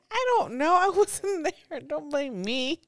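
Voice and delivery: high, loud protest, slowing on the last words; exasperated retort. Retold or imitated. Mom retelling her comeback to her daughter, loud and high. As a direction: throw the blame back, loud and high. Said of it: "I said".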